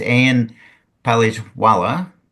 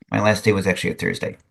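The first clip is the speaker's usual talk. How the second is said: ordinary talk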